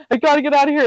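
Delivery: high, urgent, panicked repeat; panicked yelling. Retold or imitated. Begging husband to let her out, high panicked urgency. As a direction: say it again just as desperate. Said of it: "I'm, like, yelling, freaking out".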